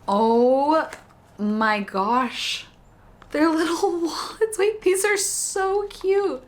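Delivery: high, delighted squeals over a gift; thrilled, pitch jumping way up; excited. Real, not acted. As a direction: gush with real surprise and joy. Opening a handmade gift.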